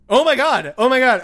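sudden loud oh my God; blurting out a shocked realization; startled exclamation. Real, not acted. Sudden realization mid-recording.

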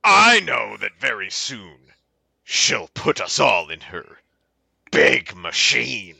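measured dramatic poetry reading; mock-earnest verse with pauses; poem recital. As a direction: recite it deliberately, phrase by phrase. Reads a poem aloud.

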